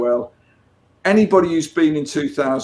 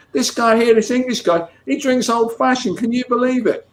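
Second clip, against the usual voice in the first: high-pitched mock excitement; acted-out delighted disbelief; amazed quote; 10 semitones higher than usual. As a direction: imitate him marvelling to his wife, far higher. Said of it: "to his wife".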